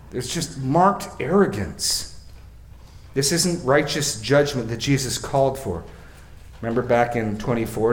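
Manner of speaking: ordinary talk, nothing marked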